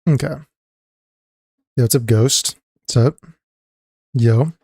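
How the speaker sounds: ordinary delivery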